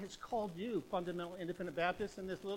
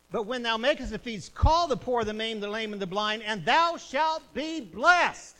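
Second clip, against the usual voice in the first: louder, hammering repeat of "call the poor"; thundering repeat; 3 semitones higher, 12 dB louder than usual. Acted. Preacher repeating the verse to the church, even louder. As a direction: pound the verse home.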